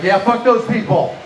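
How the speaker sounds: high-pitched mock outrage; rally the crowd in exaggerated agreement; mock indignation. Voicing everybody's indignant agreement.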